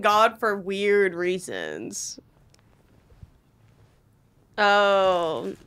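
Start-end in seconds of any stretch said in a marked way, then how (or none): none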